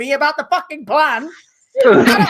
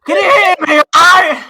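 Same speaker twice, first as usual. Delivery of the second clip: calling out loud; 3 semitones higher, 7 dB louder than usual. Real, not acted. Checking if Zoom works.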